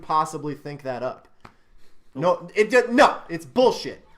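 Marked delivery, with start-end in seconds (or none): 2.1-4.0 raised, louder, heated protest; really fed up with the movie, said loudly; angry outburst. Real, not acted. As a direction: blurt it out in real irritation. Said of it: "You're so angry".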